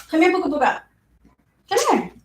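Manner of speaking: high coaxing call to the dog; pet talk to dog. Real, not acted. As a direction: coax the dog over in a high voice. Calling her dog over.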